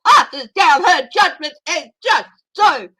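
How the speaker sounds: hammed-up stern TV judge; Jeanine Pirro impression. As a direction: hand down a verdict like a TV judge. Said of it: "I can do my Jeanine all day".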